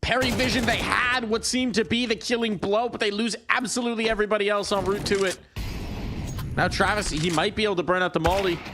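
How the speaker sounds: high, fast esports casting; excited play-by-play. Real, not acted. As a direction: ride the action's momentum. Hype play-by-play.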